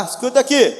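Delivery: lightly voiced officer; mock stern policeman. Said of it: "a polícia vai lá".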